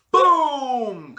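loud high boom, falling away; drawn-out booming explosion sound. Retold or imitated. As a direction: boom it out like a blast. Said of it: "Kinda like an explosion".

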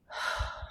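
long, soft breathy sigh; demonstrated exhale. Shown as a demonstration. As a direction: let a full breath out audibly.

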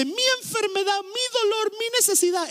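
high, possessive whine on each mi; self-pitying whine. Acted. Preacher imitating someone clinging to their problems, high whine. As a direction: clutch each trouble as your own.

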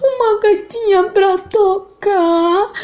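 menino agudo chorando, lento; crying boy. Acted. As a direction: responde soluçando. João chora. (menino chorando)

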